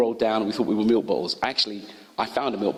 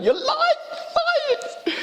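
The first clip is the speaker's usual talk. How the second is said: put-on very high, slow whiny child's protest; scared little boy; much slower than usual. Frightened boy protests to the kind nurses, very high and slow. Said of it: "I'm going".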